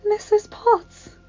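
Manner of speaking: slow, high, awed recognition; breathed in wonder. A narrator's reading. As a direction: breathe the name out slowly, high in the voice. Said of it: "Belle breathed".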